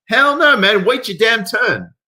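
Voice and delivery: high, loud, slowed outraged shout; outraged yell. Real, not acted. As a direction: yell it, then drag out each word. Outraged rant at line cutter.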